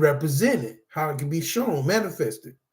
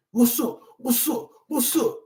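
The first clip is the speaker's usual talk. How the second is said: mock aggressor; 5 semitones higher than usual. Mimics aggressive confrontation.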